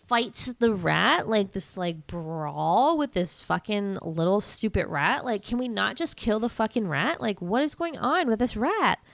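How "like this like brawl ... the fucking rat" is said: ordinary delivery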